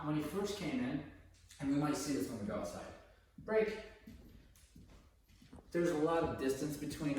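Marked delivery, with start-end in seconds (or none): 3.5-3.8 sharp high release call; command. Real, not acted. Trainer releases the dog with a quick loud call.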